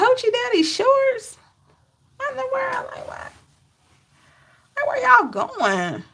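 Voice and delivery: high, incredulous mock outrage; shocked disbelief. Retelling an Instagram shock to viewers, high incredulous voice. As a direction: pitch it way up in stunned disbelief. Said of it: "I'm like".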